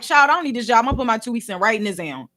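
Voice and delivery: a hint of careless I-quit; dismissive quit threat. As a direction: toss it away like you don't care. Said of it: "I'm like".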